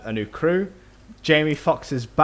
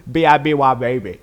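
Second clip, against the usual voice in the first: put-on Southern accent; 7 dB louder than usual. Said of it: "his face has to move in a weird way".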